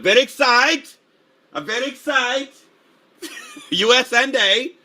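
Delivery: high, loud hyped squeal of excitement; mock excited. Streamer hamming up excitement at the chat. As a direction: cheer it like a giddy fan.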